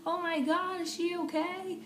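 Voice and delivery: high-pitched alarmed question; mock alarm in a high voice; imitated concerned bystander. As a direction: act out a worried onlooker, pitched well up. Acts out alarmed driver behind.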